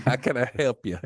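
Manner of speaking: playful Texan phone greeting; mock Southern offer of help. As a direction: put on a Texan accent. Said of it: "deep Southern Texan".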